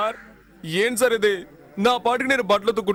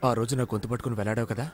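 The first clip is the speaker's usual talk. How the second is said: low menacing threat; 10 semitones lower, 7 dB quieter than usual. Acted. Jailed man threatens the woman.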